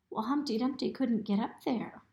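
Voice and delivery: ordinary delivery, nothing marked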